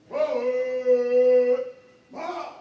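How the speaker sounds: drawn out parade commands. Real, not acted. Drill leader calling commands.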